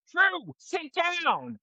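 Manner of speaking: high, drawn-out mock yell; mom shouting. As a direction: imitate a mom calling out to her kid, very high and stretched. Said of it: "The mom who kept shouting".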